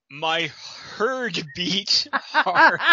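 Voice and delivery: slow, high, loud melodramatic line; hammed-up romance narrator; dramatic slow read. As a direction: overplay it like a swooning romance.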